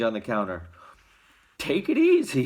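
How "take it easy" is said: higher, kindly shopkeeper voice; easy now, fatherly; gentle warning from the shopkeeper. A narrator's reading. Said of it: "he said".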